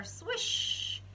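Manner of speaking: whispered high swish sound; breathy whoosh of a paper plane; light swooshing noise; airplane swish. Acted. Paper airplane flies through air.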